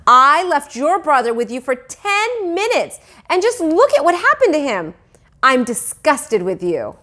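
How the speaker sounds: loud, high scolding. A narrator's reading. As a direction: scold him hard. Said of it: "My mother raised her voice".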